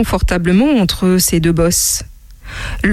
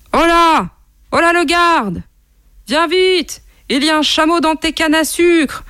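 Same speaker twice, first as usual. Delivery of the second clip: high-pitched calls for help; calling out; 8 semitones higher than usual. Acted. Monkey calling the guard about a camel, calling out high. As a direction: raise the alarm, voice pitched right up. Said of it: "tout en continuant à appeler le garde".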